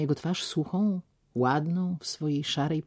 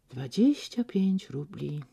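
slow, softer schoolboy repeating; softer, slow rote repetition; 3 semitones higher, 4 dB quieter, slower than usual. Acted. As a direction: recite it like a lesson to yourself. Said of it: "powtórzył znowu jak uczniaczek".

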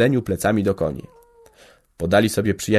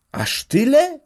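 much higher astonished lady; organist's wife astonished at the sale; surprised exclamation; slower than usual. A narrator's reading. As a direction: gasp it out in amazement. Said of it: "wykrzyknęła organiścina".

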